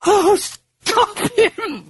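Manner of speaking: very high, slow wailing plea; high tearful cry; weeping. Acted. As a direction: weep it out in terror. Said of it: "she wept".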